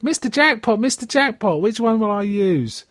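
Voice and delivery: put-on high, frail old-lady voice. Recapping a TV scene, old lady pleading, voiced high. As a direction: squeak it up like a doddery old lady. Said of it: "the old lady comes and says".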